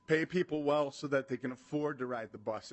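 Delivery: ordinary delivery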